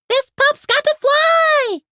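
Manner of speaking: very high, slow, stretched cry; excited exclaim. Acted. Sky cheering to Ryder before takeoff. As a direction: sing out the catchphrase. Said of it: "Sky exclaimed".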